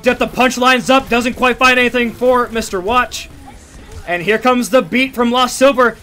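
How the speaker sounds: energetic live esports casting; play-by-play commentary. Real, not acted. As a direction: call the fight as it happens.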